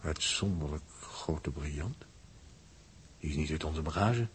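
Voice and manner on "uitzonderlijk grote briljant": hushed, slow and breathless with wonder; soft slow marvelling whisper; awed whisper. Acted. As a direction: whisper it as if the gem might vanish. Awed at finding a crystal.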